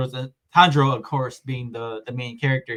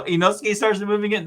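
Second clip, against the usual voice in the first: ordinary talk, nothing marked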